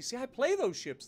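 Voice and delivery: ordinary talk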